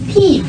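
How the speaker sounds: crisp high Peep to copy; over enunciating. Shown as a demonstration. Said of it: "My turn now".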